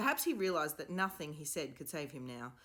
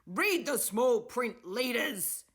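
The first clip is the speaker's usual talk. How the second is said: slow, loud, high angry shout; shouted angrily; 8 semitones higher, 10 dB louder, much slower than usual. A narrator's reading. Furious professor shouts slowly at the pupils. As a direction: spit each word at the pupils. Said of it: "he shouted angrily".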